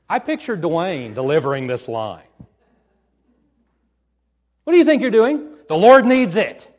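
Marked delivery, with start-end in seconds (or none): 4.6-5.6 loud, high demand; indignant challenge. Acted. Owner challenging the man taking the colt, loud indignant demand. Acts owner before Dwayne's bold answer. 5.7-6.7 loud, high, ringing declaration; bold confidence. Acted. Disciple answering the owner back, bold confident declaration. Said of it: "With bold confidence".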